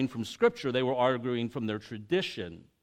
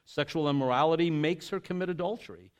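measured, solemn reading of scripture; formal Bible reading voice; 2 semitones lower, 4 dB quieter than usual. Acted.

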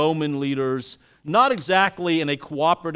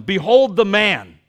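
proclaiming; 4 semitones higher, 9 dB louder than usual. Acted. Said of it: "Pilate said to them".

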